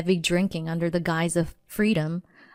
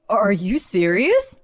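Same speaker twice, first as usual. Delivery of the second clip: stunned disbelief; 4 dB louder, slower than usual. Acted. Husband stunned by divorce papers.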